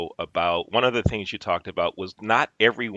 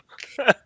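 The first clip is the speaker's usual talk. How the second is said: light laugh. Real, not acted. Laughs after joke.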